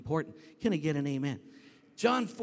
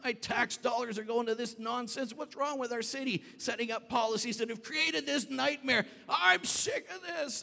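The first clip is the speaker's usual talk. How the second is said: ranting; 9 semitones higher than usual. Retold or imitated. Pastor ranting at city officials about the mess, angry tirade. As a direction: rant indignantly, peaking on the last words. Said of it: "we're ranting at, at people".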